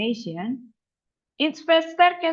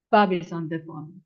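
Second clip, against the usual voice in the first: "Bob is on the phone" linked smoothly, lower pitch; connected in one breath; 5 semitones lower than usual. Shown as a demonstration. English teacher correcting the broken version, all in one breath. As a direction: say BOB-IS-ON-THE-PHONE as one unbroken sound group. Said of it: "With one time breathing".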